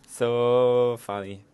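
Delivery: loud laugh, then slow amused words. Real, not acted. Laughing at failing call.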